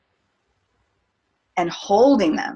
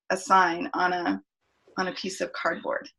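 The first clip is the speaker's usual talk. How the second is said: normal talking voice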